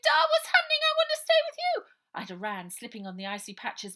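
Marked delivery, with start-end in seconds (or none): 0.0-1.9 very high, loud and fast in terror; panicked child. Acted. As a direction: cry out desperately for your sister. Bonnie dragged off, calling to Ida.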